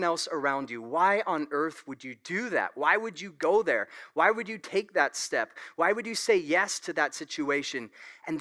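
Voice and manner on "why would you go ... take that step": ordinary talk, nothing marked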